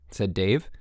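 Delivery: ordinary delivery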